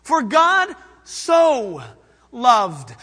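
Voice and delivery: "so" drawn out slowly and loud; stress on so. Shown as a demonstration. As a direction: linger on "so". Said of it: "Not God loved. He so loved".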